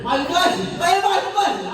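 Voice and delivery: high mock shout across the street; fans calling out. People spotting him, shouting his name. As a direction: call out a celebrity's name. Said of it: "they was like".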